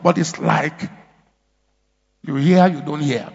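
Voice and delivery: ordinary talk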